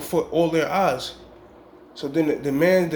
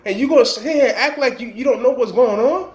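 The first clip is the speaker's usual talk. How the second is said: angry shooter; 6 semitones higher, 4 dB louder than usual. Retold or imitated. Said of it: "Basically, he said".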